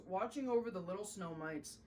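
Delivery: plain reading voice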